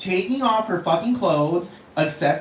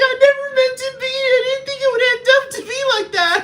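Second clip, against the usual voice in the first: high, loud fake sobbing; mock crying; 4 dB louder than usual. Gaga at her mugshot, tearfully pleading in hammed-up crying. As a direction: whimper the apology in mock tears. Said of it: "she'd be like".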